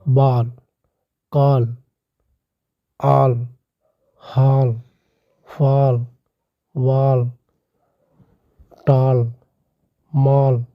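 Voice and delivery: बॉल, कॉल, ऑल, हॉल with a long open aw vowel, each word set apart; example words read out. Shown as a demonstration. Teacher reading the A-before-L examples, clearly articulated. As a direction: drill बॉल कॉल ऑल हॉल फॉल वॉल टॉल मॉल one by one. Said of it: "साथियों क्या हो गया".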